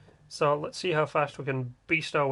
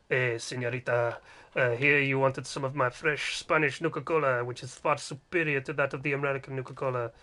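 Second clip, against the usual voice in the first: low, soft Spanish seducer; mock-seductive telenovela voice, low and unhurried; telenovela suitor; 3 semitones lower, 4 dB quieter than usual. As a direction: play a hammy Spanish lover, slowing towards the end. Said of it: "that shitty telenovela".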